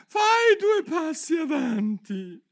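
loud, very high, slow shout; called-out command. A narrator's reading. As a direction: shriek out the dance step. Calling out dance steps. (comando urlato e acuto)

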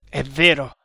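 mock agreement, loud and dry; ironic. A narrator's reading. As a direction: concede it with a sneer. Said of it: "disse Tremal Naik con ironia".

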